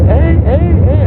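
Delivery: ordinary delivery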